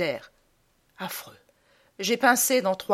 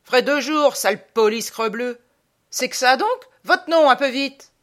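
loud, high sergeant's shout; peremptory parade-ground yell; old sergeant; 5 semitones higher, 7 dB louder than usual. Acted. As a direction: bawl out a punishment at a recruit. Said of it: "Un vieux sergent me dit".

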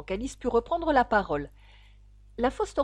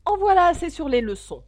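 high, loud, firm interruption; very decided; 8 semitones higher, 9 dB louder than usual. A narrator's reading. Gryphon interrupts talk of lessons, very decided. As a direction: cut the talk off decisively. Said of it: "intervenant d'un ton très décidé".

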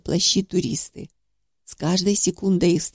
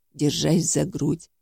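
plain reading voice

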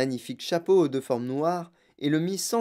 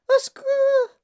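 very high, loud shriek for help; panicked scream; 8 dB louder, slower than usual. A narrator's reading. Mother thrown to the lift floor, screaming for help. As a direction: scream for rescue in falsetto. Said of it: "cria madame T V".

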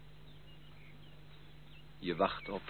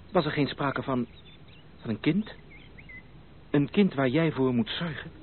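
hopeful surprise; 5 semitones higher, 4 dB louder than usual. Acted. Leo asks eagerly about a child.

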